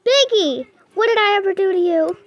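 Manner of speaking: loud, high outraged protest; indignant yell. Real, not acted. Player yelling at the chasing monster, indignant and loud. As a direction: shout it at the monster chasing you.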